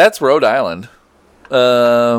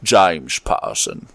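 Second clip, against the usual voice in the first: Sean Connery attempt; 7 semitones lower, slower than usual. Shown as a demonstration. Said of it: "that's more Daniel Craig".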